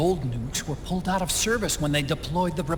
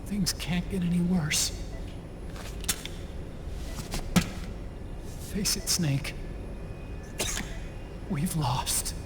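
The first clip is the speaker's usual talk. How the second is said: defeated hush; 7 dB quieter than usual. Acted. Otacon giving up.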